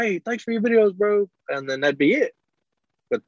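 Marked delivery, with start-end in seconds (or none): 0.0-1.3 light, higher fan voice; lightly voiced grateful fan, higher; imitating a fan. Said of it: "They'd be like".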